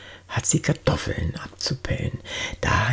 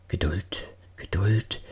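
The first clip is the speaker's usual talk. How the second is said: tief und leise zur Geduld mahnen; gedämpft, mit kleiner Pause dazwischen; leise beschwichtigend; 5 semitones lower, 6 dB quieter than usual. A narrator's reading. Erzähler mahnt beim Kochen zur Geduld. (sanft vertröstend)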